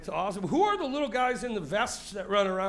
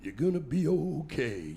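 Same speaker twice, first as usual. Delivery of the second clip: [name]; 3 semitones lower than usual. Retold or imitated. Imitating [name] reassuring the comic, slow crooning. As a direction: croon it like a comfort. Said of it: "when Neil says".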